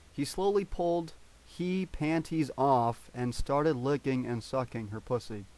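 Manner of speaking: ordinary delivery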